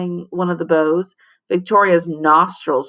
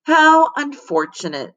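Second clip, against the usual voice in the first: higher, dry how unfortunate; mock sympathy; 8 semitones higher than usual. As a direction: feign sympathy.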